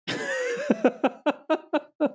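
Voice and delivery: high-pitched staccato cackle; long, helpless burst of high laughter; real laughing fit. Real, not acted. Cracking up at the cat.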